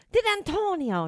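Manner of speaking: high, teasing snippet of an impression; impersonated new wife. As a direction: briefly mimic the ex's new wife, high-pitched. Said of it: "No, I'm not gonna do it".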